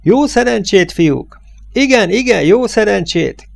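called-out farewell. A narrator's reading. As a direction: yell it after the departing truck. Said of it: "Valaki oda kiáltotta nekik".

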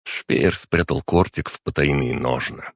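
ordinary delivery

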